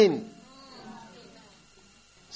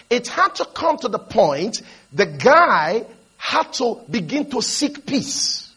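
ordinary delivery

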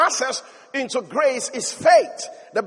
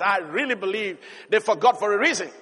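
ordinary talk, nothing marked

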